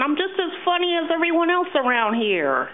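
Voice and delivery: high, loud puppet voice; puppet character voice. Acted. Puppet delivering a line for the take, high character voice. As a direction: pitch it way up for a puppet protest. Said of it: "that's my voice".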